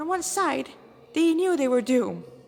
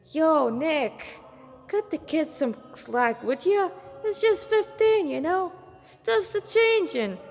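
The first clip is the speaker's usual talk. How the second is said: higher, louder, easygoing drawl for the slacker hunter; laid-back teasing buddy; 7 semitones higher, 4 dB louder, slower than usual. Acted. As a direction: tease the old man into going easy on the kid. Manabu teasing the elder hunter.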